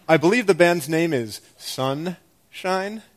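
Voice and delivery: ordinary delivery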